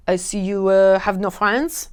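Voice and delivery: mock French accent, slow and snooty. Mocking solo traveler, mock French accent. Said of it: "they still have a French accent".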